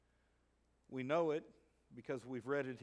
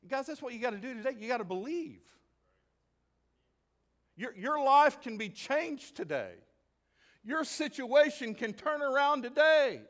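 loud, high exhortation; preaching at full force; 12 semitones higher, 13 dB louder than usual. Acted. Preacher urging the congregation to believe. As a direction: preach it to the room, full power.

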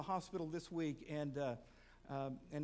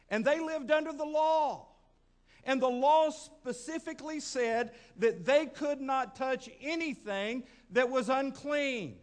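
higher, louder, driving sermon voice; full-force preaching; 6 semitones higher, 8 dB louder than usual. Acted. Preacher thundering to the congregation about the priest and Levite, loud. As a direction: preach it with mounting fire.

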